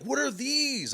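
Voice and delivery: high, imitated kid's question; mock kid curiosity; curious kid. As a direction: imitate an amazed kid, voice shooting up. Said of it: "He's like".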